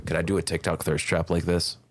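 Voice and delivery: hoarse sick voice from a cold. Real, not acted. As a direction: croak through a sore throat. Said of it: "Doesn't my voice sound sick?".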